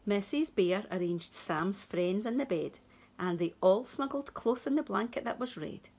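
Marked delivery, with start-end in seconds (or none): none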